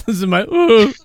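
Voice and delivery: strained hoarse voice. Real, not acted. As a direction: voice giving out. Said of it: "I'm losing my voice".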